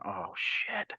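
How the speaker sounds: a whispered, sinking curse; whispered dread. Retold or imitated. Kid realising he'll be picked, whispering in dread. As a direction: breathe it out in a panicked whisper. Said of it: "And I'm going".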